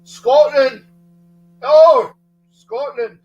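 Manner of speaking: loud, high mock Scottish shout; Scottish accent. Streamer hailing his friend's country, in a shouted Scottish accent. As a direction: bellow it like an over-the-top Scot. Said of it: "do my Scottish accent".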